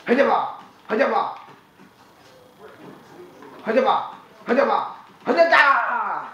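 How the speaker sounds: chanted nonsense war cry; silly battle cry. Acted. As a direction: shout the nonsense word playfully.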